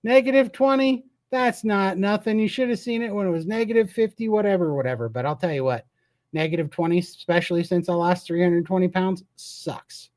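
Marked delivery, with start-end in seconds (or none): 0.0-5.1 higher put-on voice, shrugging off the cold; mock tough local. Mocking Montanans who shrug off cold, in a put-on voice. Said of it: "I'm supposed to go". 9.4-10.1 breathy whispered "sucks", very soft; whispered aside. Real, not acted. Confiding to viewers that the cold sucks, whispering.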